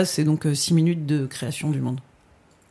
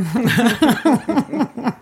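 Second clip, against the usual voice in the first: rire franc; 7 semitones higher, 4 dB louder than usual. Real, not acted. Laughs after the clip ends.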